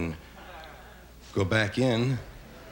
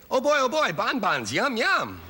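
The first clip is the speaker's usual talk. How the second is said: put-on high, giddy oh boy; mock childish delight, much higher; gleeful; 10 semitones higher than usual. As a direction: squeal it like a kid seeing candy. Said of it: "Like you say".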